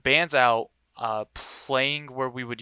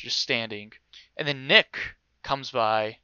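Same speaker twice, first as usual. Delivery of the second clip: ordinary talk, nothing marked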